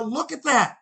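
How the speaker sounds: high, eager look at that; excited. Retold or imitated. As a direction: point it out, amazed. Showing wife the face.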